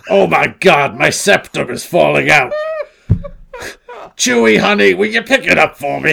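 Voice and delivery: put-on high, loud, dramatic old star; mock Carrie Fisher. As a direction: shriek it like a faded diva. Parody of aging Carrie Fisher.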